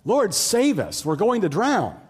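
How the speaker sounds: high, loud cry for help; panicked shout. Acted. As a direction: shout like a disciple on a sinking boat.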